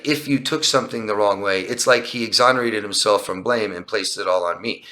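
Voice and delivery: ordinary talk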